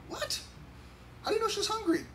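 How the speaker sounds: high-pitched incredulous "what?"; baffled reply. Retold or imitated. Husband baffled by wife reading baby's cries, high and incredulous. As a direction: sound totally baffled by your wife.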